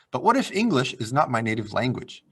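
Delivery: usual speaking voice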